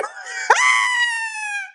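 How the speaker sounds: high, loud burst of laughter; shrieking laugh. Real, not acted. Quoting Grok's reply, she bursts into shrieking laughter. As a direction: let a shrieking laugh explode.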